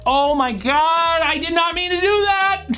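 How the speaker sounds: high-pitched horrified gasp of disbelief; shocked misclick. Real, not acted. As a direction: squeal at the blunder you just made. Misclick shock in chess game.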